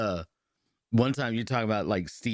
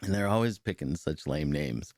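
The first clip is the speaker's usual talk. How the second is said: ordinary delivery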